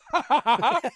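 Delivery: high, loud put-on ha ha; mock forced laugh. Chinese audience member alone in the silence, a forced high laugh. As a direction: fake the laugh flatly. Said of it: "just goes".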